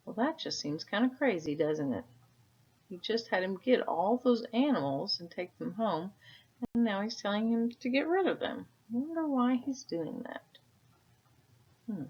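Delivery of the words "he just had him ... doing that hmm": usual speaking voice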